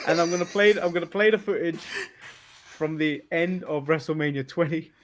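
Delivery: ordinary talk, nothing marked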